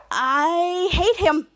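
long stretched first word, rising; mock loathing. Miles reacting to Krampus, loathing. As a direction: draw it out with hammed-up disgust. Retold dislike of villain.

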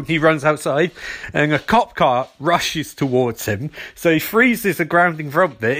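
usual speaking voice

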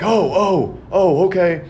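high, surprised "oh, okay" of a caught man; mock startled neighbor. Imitating the neighbor who got caught, high and startled. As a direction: play him caught out and suddenly agreeable.

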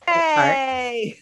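loud happy shout; excited cheer. Real, not acted. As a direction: whoop with delight. Cheering the yes answer.

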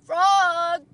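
long, high, loud call; Toad's cry. A narrator's reading. Toad nears Frog on the island, crying out. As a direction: cry out pleadingly to a friend. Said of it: "cried Toad".

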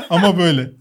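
very high burst of laughter. Retold or imitated. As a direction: laugh high and hard, then speak through it. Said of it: "şöyle bir ses geliyor".

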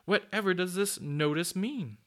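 high-pitched, puzzled surprise; surprised. A narrator's reading. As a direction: ask in lively storybook astonishment. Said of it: "she said in surprise".

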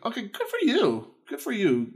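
high, sing-song approval; sarcastic good for you; mock praise. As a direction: patronize her cheerfully. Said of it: "I'm like".

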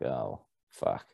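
low, soft, sinking swear; deflated retold reaction. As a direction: let it drop, quietly defeated. Retelling reaction to a bad score.

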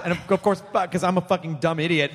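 ordinary talk, nothing marked

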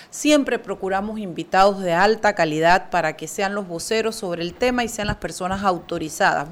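normal talking voice

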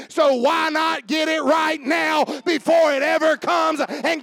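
loud, high, driving preacher's cry; full-force preaching. Acted. Preacher warning Josh and the church, loud and high. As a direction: hammer each warning home.

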